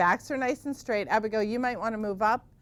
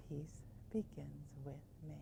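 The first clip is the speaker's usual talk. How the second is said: unvoiced soft whisper; 6 semitones lower, 19 dB quieter than usual. Shown as a demonstration. Teacher whispering the bedtime mantra. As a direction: whisper it as if falling asleep. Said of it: "It's hard to settle down".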